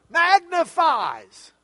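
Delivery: "magnifies" drawn out, high and loud; emphasis on magnifies. Shown as a demonstration. Teacher shows the class the verb that starts the verse, emphatic "magnifies". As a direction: punch "magnifies" slowly, stretching it out. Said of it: "to put emphasis on certain words".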